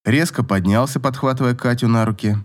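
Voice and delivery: plain reading voice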